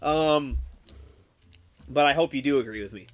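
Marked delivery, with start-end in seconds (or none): none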